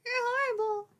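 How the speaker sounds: high, whiny mock outrage. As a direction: whine it like a hurt child. Teasing friend, mock outrage.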